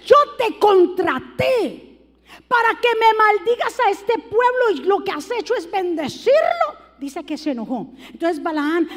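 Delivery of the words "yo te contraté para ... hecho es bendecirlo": Balac enojado. Retold or imitated. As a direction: reproche exasperado del rey que contrató al profeta. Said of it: "Balac se enoja". (leve enojo imitado, un poco más fuerte)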